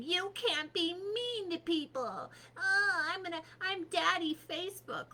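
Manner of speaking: high mocking scold; mocking the platforms. Mocking the platforms that ban her, high scolding voice. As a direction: sneer it like a smug tech boss lecturing. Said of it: "platforms saying".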